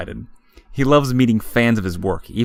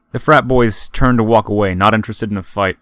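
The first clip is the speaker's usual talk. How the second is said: ordinary delivery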